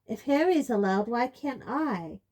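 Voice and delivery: loud, high indignant shout; teen son shouting at his mother. A narrator's reading. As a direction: shout it, slowing at the end. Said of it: "shouted Ron".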